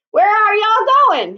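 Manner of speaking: high, loud call across the road. Acted. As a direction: shout it out to the passing crowd. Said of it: "he called out".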